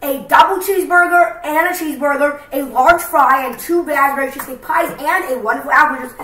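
upbeat loud sign-off; vlog outro. Acted. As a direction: sign off the video brightly.

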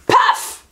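high, sudden imitated thump; impact noise. A narrator's reading. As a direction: punch out the ball's landing. Sound of the ball landing.

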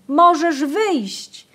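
loud, high and slow impatient call; impatient shout. A narrator's reading. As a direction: shout so she hears in the corn. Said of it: "krzyczeli zniecierpliwieni".

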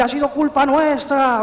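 high-pitched hammed-up lament; frantic townsfolk. Villagers of San Vicente as everyone goes crazy, high mock lament. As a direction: wail it high in mock panic. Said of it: "La gente estaba toda como loca".

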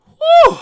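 a real, very high, loud whoop of joy; excited whoop. Real, not acted. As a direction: let out a big happy woo. Card pull excitement.

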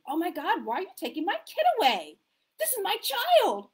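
put-on cling to the child in rising alarm; panicked parent. Caregiver at the handover, panicked and frantic.

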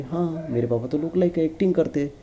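put-on little girl. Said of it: "तो बोली". (बेटी की ऊँची, धीमी आवाज़ की नकल)

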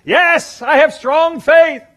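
high, loud, slow and earnest assurance; a desperate man promising God he has faith; eager yes. Retold or imitated. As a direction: insist hard that you believe.

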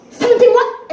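loud, high outburst of disbelief; shocked. Acted. Client hearing the seventeen-thousand-dollar bill, shocked. Client hears the huge debt.

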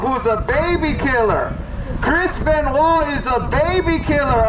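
usual speaking voice

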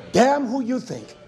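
ordinary talk, nothing marked

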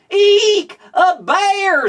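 high, loud squeal of fright; frightened scream. Acted. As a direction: shriek at the sight of a bear. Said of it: "screamed the girl".

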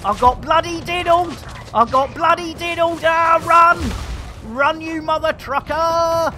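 high, loud flustered shouts; worked-up gamer in a frantic panic; panicked yelling. Real, not acted. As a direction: cry out as the attack hits, then flee. Panics at attack in game.

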